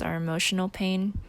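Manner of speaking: ordinary talk, nothing marked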